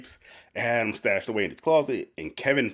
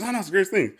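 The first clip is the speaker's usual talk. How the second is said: giddy Andy; 9 semitones higher, faster than usual. Retold or imitated. Andy defends organ purchase.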